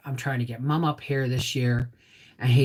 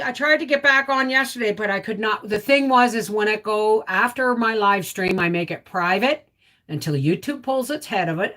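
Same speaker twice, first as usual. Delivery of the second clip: normal talking voice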